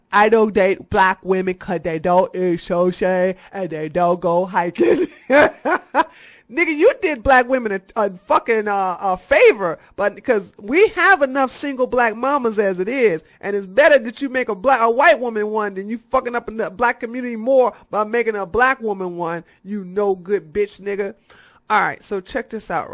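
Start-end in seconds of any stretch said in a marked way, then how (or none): 0.1-5.1 louder put-on voice, laughing at the end; mocking quote. Mocking the YouTuber with a laugh. 5.3-6.0 high loud burst of laughter. Real, not acted. Laughing at the YouTuber's quote. Laughing at his own parody. 6.5-19.4 louder, faster scolding; tearing into him; heated rant. Real, not acted. Scolding the YouTuber, louder and heated.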